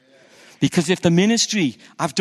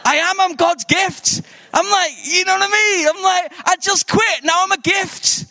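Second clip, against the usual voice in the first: laughing mock boast; 3 dB louder than usual.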